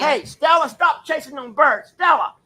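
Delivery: loud, high call across the yard. Real, not acted. Man yelling at dog to stop chasing birds.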